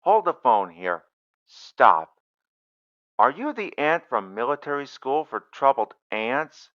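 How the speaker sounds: loud urgent "wait, wait"; loud interruption. Acted. Doctor halts the ant on the phone, loud and urgent. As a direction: cut the caller off loudly.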